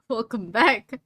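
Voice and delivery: fancy welcome. Pretend. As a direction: drawl it out like fancy lettering. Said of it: "in fancy font".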